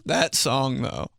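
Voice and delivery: ordinary talk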